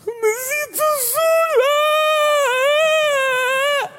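very high, crying mock sob; mock crying wife feeling lonely; imitating sobbing wife. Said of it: "empieza a llorar".

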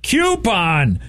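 high-pitched yelled-out answer, stretched; mock yell. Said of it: "He just yells it out".

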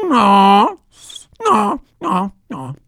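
high, loud, whiny bray of no; braying donkey. Acted. The donkey refuses the grandfather, high whiny bray.

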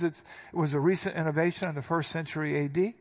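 ordinary delivery

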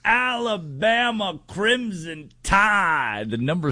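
high, drawn-out hype call of the team name; Pat McAfee impression. Radio host introducing the big Alabama game in a Pat McAfee voice. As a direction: stretch it out like a big game-day hype man. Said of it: "I'm gonna do my best Pat McAfee impression".

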